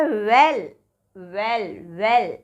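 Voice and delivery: "well" with lips rounded on the w. Shown as a demonstration. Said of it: "well बोलेंगे तो हमारा mouth round". ("well" होंठ गोल करके)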